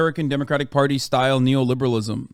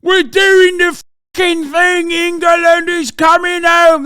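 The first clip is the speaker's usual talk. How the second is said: shouted much higher and louder, dragged out slowly, a chanting lad; mocking English football fan; 14 semitones higher, 8 dB louder, much slower than usual. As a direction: ham up a rowdy English fan cheering at the top of his lungs. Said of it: "This is how they speak".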